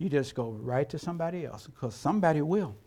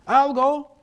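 eager call; 8 semitones higher, 8 dB louder than usual. Acted. Repeated answer to God.

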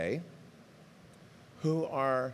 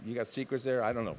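usual speaking voice

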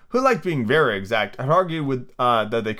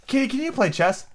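Alice asking kitty; 12 semitones higher than usual. Acted. Alice asks kitten, high voice.

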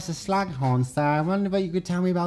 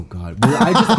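ordinary delivery

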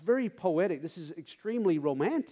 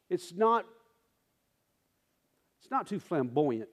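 ordinary talk

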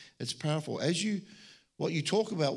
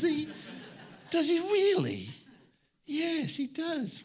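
high-pitched mock disbelief; astonished; 11 semitones higher, slower than usual. God hearing the centurion knows him, high astonished. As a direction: squeak out amazed gossip.

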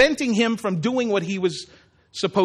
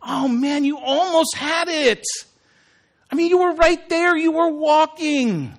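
Jesus cheering Peter on; 8 semitones higher than usual. Retold or imitated. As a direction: cheer him on like a proud coach. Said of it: "I see Jesus going".

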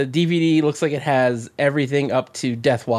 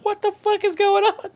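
much higher, incredulous laughing squeal. Retold or imitated. Watching the show in disbelief, high incredulous squeal. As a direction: shriek it out in stunned disbelief. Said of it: "I was fucking dying".

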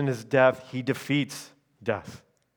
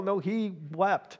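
normal talking voice

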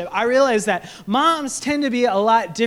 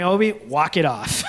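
lower, dismissive dad voice; gruff dad; 6 semitones lower than usual. Retold or imitated. Dad telling his fallen son to shake it off, gruffly. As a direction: brush it off like a tough coach. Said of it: "I'm like".

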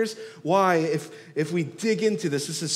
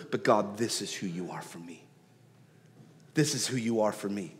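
soft prayer; 7 semitones lower, 7 dB quieter than usual. Acted. Voicing David quietly.

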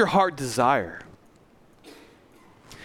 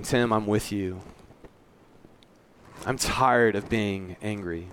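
softer, slow and worn out; weary confession; 6 semitones lower, 5 dB quieter, slower than usual. Retold or imitated. Listener telling the pastor they're tired of anger, softer and slow. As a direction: let the tiredness drag the words.

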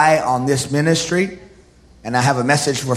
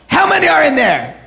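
high, incredulous demand; animated comic interrogation; Jesus interrogating demons; 13 semitones higher, 4 dB louder than usual. Acted. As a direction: question them in a raised, pitched-up voice. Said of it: "And Jesus is like".

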